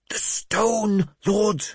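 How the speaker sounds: high-pitched, intense hiss. A narrator's reading. Mad bishop demands the stone from his lord, hissing. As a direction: press it urgently in a high voice. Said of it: "he hissed".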